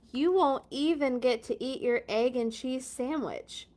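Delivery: ordinary delivery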